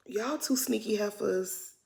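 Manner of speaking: sighing whisper at the screen. Real, not acted. Whispering to the cast on screen, sly accusation. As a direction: breathe it out as a sly aside.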